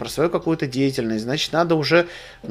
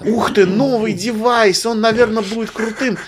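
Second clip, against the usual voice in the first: imitating wow excitement; 6 semitones higher than usual. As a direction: play the dazzled gadget fan. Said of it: "Вау-эффект".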